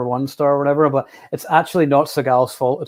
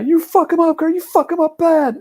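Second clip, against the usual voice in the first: much higher, louder egging-on yell; movie brother's shout; 4 dB louder than usual. Retold or imitated. Kurt's brother egging him on in Kickboxer, shouted. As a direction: urge Kurt on to beat him. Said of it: "he's like".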